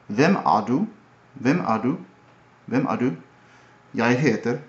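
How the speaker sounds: Hvem er du with a rising Norwegian lilt; Norwegian accent. Shown as a demonstration. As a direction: sing it up at the end, Norwegian style. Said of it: "Norwegian is more like".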